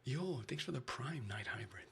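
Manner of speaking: normal talking voice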